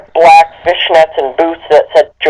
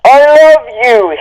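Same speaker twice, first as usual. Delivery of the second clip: hammed-up lovesick cry, higher and drawn out; a touch of mock heartbreak, higher pitched; mock sad shout; 7 semitones higher, slower than usual. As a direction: read it like a melodramatic goth boy pining. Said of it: "he shouted sadly".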